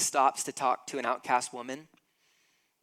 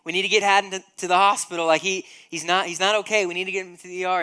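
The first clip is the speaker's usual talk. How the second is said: higher, louder, pressing urgency; husband urging wife to leave for hospital, anxious; urgent plea; 5 semitones higher, 7 dB louder, faster than usual. Retold or imitated. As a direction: hurry her along, anxious. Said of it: "the whole time I'm going".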